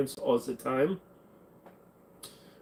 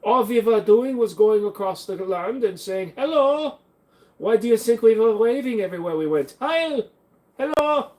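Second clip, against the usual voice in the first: higher, mock German villain accent; 7 semitones higher than usual. Mocking a German officer to chat in a German accent. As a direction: ham up a German accent, rising into the greetings. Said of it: "I meant to go into this accent".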